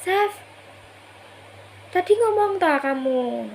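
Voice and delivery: mild re-enacted yell; retold call-out. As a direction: call out a question from the bathroom. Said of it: "Saya teriak gini".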